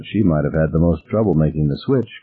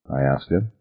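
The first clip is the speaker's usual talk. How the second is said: usual speaking voice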